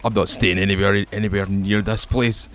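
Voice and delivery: ordinary delivery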